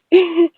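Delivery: high, loud burst of laughter; short high cackle; amused laugh. Real, not acted. Laughing at enemies' outrage.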